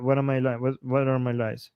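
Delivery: ordinary delivery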